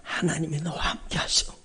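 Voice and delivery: breathy whisper of "하나님이 너와 함께 하셔"; hushed whisper, almost no voice. Shown as a demonstration. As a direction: whisper it like a secret comfort. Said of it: "그 말을 저한테 들려주셔".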